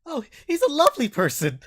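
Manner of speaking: high, loud fawning voice; eager nervous captive. Acted. Captive man flattering to his captors, high and eager. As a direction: gush over him to please.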